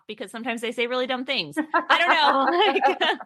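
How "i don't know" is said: laughing through the words; giggly, slow, breaking into laughter. Real, not acted. Laughing at her own joke.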